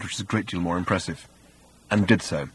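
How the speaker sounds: usual speaking voice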